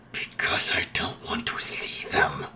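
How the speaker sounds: breathy whisper, no voice at all; whispered plea. Acted. Izuku whispering his plea to Nezu over the phone. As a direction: whisper it so the guests can't hear.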